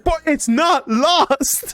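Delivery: drawn-out retort. Retold or imitated. To the teacher insisting, very slow and exasperated. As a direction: drag it out in disbelief.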